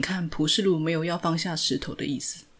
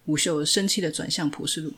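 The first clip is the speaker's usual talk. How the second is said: ordinary delivery, nothing marked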